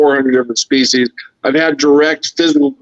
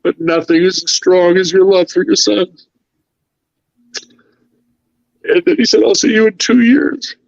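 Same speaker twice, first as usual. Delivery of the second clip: holding back tears, pitch climbing; choked up; 6 semitones higher than usual. Real, not acted. Recalling a goodbye from an alien friend, close to tears. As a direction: voice rising high as emotion takes over. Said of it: "you'll have to forgive me. I'm not an emotional person".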